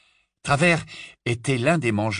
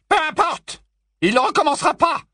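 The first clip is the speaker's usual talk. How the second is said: icy voice; 6 dB louder than usual. Acted. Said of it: "d'une voix glaciale".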